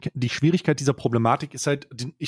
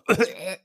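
sudden higher cough; coughing fit; 5 semitones higher than usual. Real, not acted. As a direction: cough cutting off the sentence. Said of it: "in einem wichtigen Gespräch seid und gerade".

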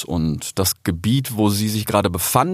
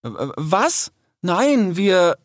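flustered stammer; 9 semitones higher, much slower than usual. A narrator's reading. Rix stammers when wrongly accused.

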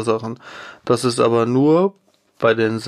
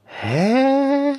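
baffled high "hä"; 14 semitones higher than usual. Real, not acted. Baffled reaction to text.

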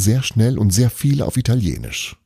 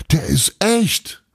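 horrified cry; 11 semitones higher, much slower than usual. A narrator's reading. Horrified contestant warning about the bear, high cry. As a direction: cry out in horror at storyteller volume. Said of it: "schrie ein entsetzter Teilnehmer".

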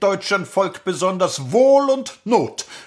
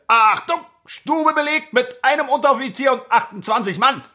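barking report; 7 semitones higher, faster than usual. Acted. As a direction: snap out the report to the lieutenant. Sergeant reports to lieutenant.

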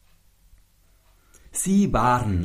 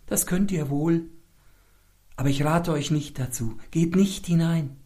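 traurig; 8 semitones higher than usual. A narrator's reading. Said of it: "mit trauriger Stimme".

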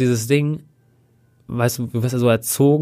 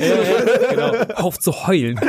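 laughing mock scold; 7 semitones higher than usual.

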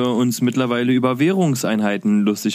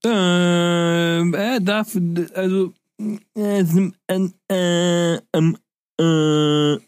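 confused stammering; 6 semitones higher, much slower than usual. Acted. Acting total confusion.

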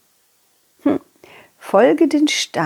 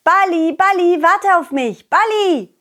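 calling out; 8 semitones higher than usual. Acted. Calling after rolling ball.

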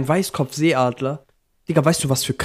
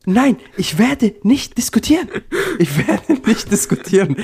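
aufgebracht hoch; 6 semitones higher than usual. Real, not acted. Refusing, voice jumps up.

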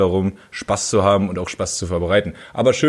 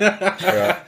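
laughing; 7 semitones higher than usual. Real, not acted. Laughing at insult.

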